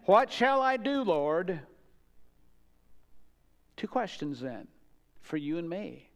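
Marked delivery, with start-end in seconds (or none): 0.1-1.6 higher and louder on the question; preaching emphasis. Acted. Preacher driving home Saul's second question, raised and loud. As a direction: lift it and press it home.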